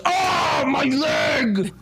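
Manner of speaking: sudden high shout of pain. Acted. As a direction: yell it like you've just been shot in the leg. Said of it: "and Ko goes".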